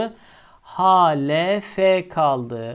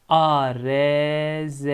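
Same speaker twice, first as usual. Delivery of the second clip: slow letters "A R Z"; slow spelling; much slower than usual. Shown as a demonstration. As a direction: dictate the root slowly. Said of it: "Kelimenin kökü ne çıktı".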